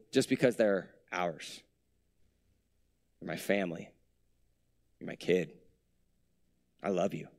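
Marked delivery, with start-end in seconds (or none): 3.2-7.3 put-on much lower and softer, like a parent to a child; tender parent. A parent telling their own child, low and soft. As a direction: hush it down, lower, all tenderness.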